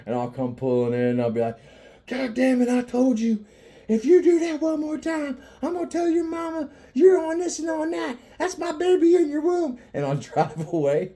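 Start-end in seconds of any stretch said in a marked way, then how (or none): 2.0-9.8 very high, loud ranting yell; hammed-up outraged scolding; mock tirade. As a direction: holler put-on accusations across a parking lot. Acts yelling at friend as prank.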